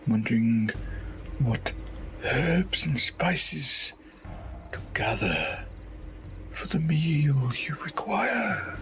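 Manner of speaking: sing-song caterer voice. Acted. Caterer startled, covering excuse.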